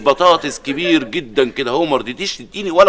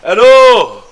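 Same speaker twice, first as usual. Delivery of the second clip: loud hello; 5 semitones higher, 8 dB louder, much slower than usual. Acted. Answering kidnapper's call.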